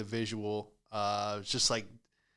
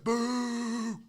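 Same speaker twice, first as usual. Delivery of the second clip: a higher, distant jeer; mock boo; 9 semitones higher than usual. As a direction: boo like the heckler in the row. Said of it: "he's like".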